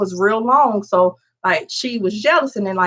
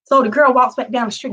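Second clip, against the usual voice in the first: ordinary talk, nothing marked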